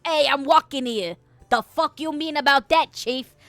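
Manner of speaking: punchy New York accent; emphatic comedic New York accent. Shown as a demonstration. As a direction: bark it like a brash New Yorker. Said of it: "sound like I'm, uh, from New York".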